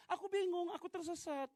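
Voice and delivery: high-pitched mock helpless wail; imitating lost wife. Wife calls back, lost in house. (menirukan istri yang kebingungan, bernada tinggi)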